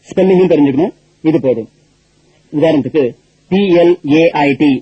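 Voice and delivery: normal talking voice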